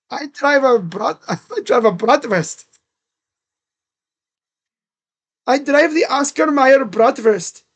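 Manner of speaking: playing a jolly German driver, laughing; mock German accent. Shown as a demonstration. Streamer jokes about his car in a mock German accent. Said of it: "Little German accent".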